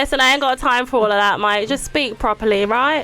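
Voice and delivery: impatient put-on Cockney; Cockney accent. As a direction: cut him off as a Cockney.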